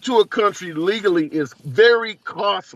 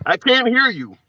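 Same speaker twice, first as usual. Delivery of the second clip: raised voice, pitched up to be heard; 5 semitones higher than usual. Real, not acted. Host repeats to bad caller.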